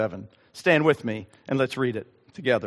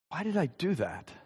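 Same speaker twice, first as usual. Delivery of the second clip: hushed, rueful question to himself; softly, privately baffled; rueful self-questioning; 2 semitones higher, 9 dB quieter than usual. Acted. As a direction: drop the voice as if asking yourself.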